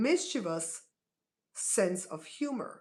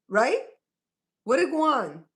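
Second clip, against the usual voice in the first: usual speaking voice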